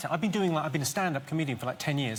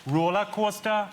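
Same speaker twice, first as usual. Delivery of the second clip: slow, higher-pitched Geordie demo; drawn-out Geordie vowels; Geordie accent; 4 semitones higher, slower than usual. Shown as a demonstration. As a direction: model a broad Newcastle accent. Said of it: "do the Geordie accent".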